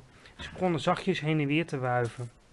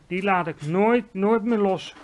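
louder, emphatic vow; determined cry; 4 semitones higher, 6 dB louder than usual. A narrator's reading. Giant vowing to hold the nightmare, determined cry. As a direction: declare it firmly, voice raised. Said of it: "riep de G V R".

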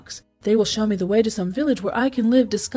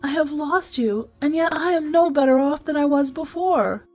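lamenting cry; 8 semitones higher than usual. A narrator's reading. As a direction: mourn in a raised, plaintive pitch. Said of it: "cried the princess".

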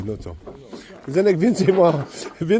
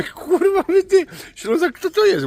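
mock astonished; 12 semitones higher than usual. Retells shocked reaction.

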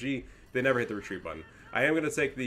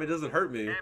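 ordinary talk, nothing marked

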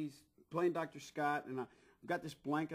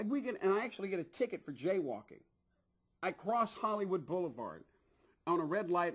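ordinary talk, nothing marked

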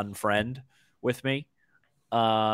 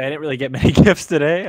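laughing; 9 dB louder, faster than usual. Real, not acted. Laughing while answering about gifts.

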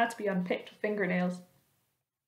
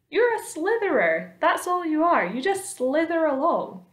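high, louder jeer; taunting centipede; 8 semitones higher, 5 dB louder than usual. A narrator's reading. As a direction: taunt the earthworm in a raised voice.

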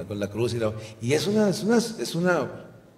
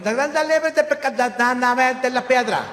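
imagined high Jesus; 13 semitones higher than usual. Pretend. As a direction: play a tiny, squeaky Jesus. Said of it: "Yo me imagino".

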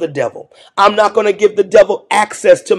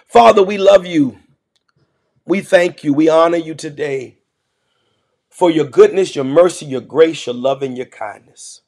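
prayer; 5 semitones lower than usual. Acted. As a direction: lead the church in prayer, gently. Pastor leads a prayer.

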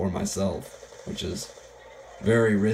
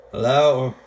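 calling out; 5 semitones higher, 7 dB louder than usual. Real, not acted. Calling out to enemy.

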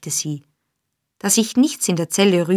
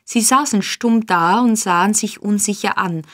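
ordinary delivery, nothing marked

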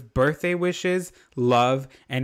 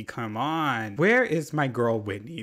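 normal talking voice

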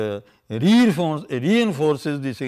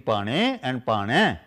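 "pane" and "pane" said slowly, the second one stressed; slow stress contrast; 4 semitones lower, much slower than usual. Shown as a demonstration. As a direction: drag out both "pane" and lean on the second. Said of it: "The first is pane and the other is pane".

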